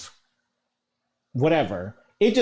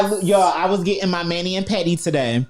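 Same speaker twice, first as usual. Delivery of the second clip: ordinary talk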